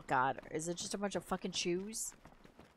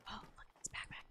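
whispering; 14 dB quieter than usual. Real, not acted. Sneaking, found loot.

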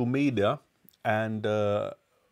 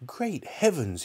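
high, startled exclamation; excited cry; 7 semitones higher, slower than usual. Acted. Mister Cranky seeing the giant hen, high and amazed. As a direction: burst out in amazement. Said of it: "he cried".

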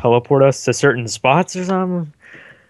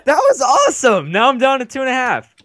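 excited; 9 semitones higher than usual. Real, not acted. Thrilled after a game explosion.

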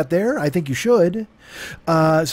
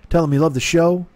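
ordinary delivery

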